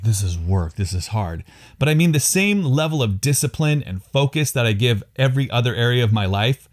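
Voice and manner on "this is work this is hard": low, downbeat mock complaint; mock dreary grumble. Husband showing how family time could sound bad, lower mock-weary grumble. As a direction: make it sound like a dreary chore. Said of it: "I don't mean it to sound bad".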